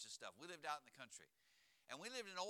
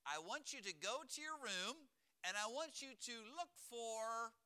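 put-on higher-pitched mom sending her kid off; raised, deliberate motherly voice; mom giving instructions; 4 semitones higher than usual. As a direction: spell it out slowly like a mother. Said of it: "when my mom would say to me".

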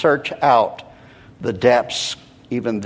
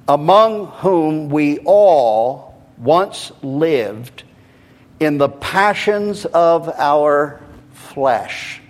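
deliberate, rhythmic Bible reading; slow scripture reading; 3 semitones higher, 4 dB louder, slower than usual. Real, not acted. Preacher reading the text for listeners to absorb, slow and deliberate. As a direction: stretch each phrase with solemn emphasis. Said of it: "I'm going to read it slowly".

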